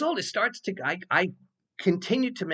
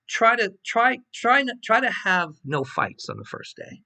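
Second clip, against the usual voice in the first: high, louder voice for the dean; dean's joking warning; 10 semitones higher, 6 dB louder than usual. Retold or imitated. Dean telling Bruce no fights on day one, high and amused. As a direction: play the dean's half-joking warning, pitched up.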